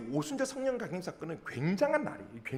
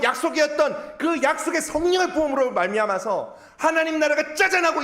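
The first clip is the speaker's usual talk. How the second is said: full-force preaching; 7 semitones higher, 6 dB louder than usual. Real, not acted. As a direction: build to a ringing declaration with weighty phrases. (힘주어 크게 선포하는 설교)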